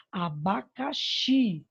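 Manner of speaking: "Abacaxi" slow, with "xi" drawn out; stress on XI. Shown as a demonstration. Teacher showing the tonic syllable, "xi" stressed in "Abacaxi". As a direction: stretch the final "xi" of "Abacaxi". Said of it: "a sílaba tônica é o xi".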